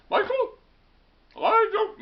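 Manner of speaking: loud, very high British mum; piercing falsetto British accent; British mother. Retold or imitated. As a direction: imitate a scolding British mother. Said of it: "that's my attempt at a British accent".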